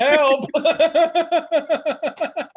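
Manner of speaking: high, loud mock cry for help; mock panicked cry. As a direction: yell it like a tripping man in a panic. Mimicking tripping friend.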